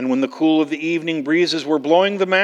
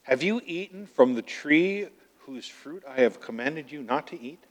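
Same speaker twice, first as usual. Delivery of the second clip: much softer, lower voice; hushed questioning; 4 semitones lower, 9 dB quieter than usual. Acted. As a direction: question them quietly and gravely, fading away. God quietly questions Adam in reading.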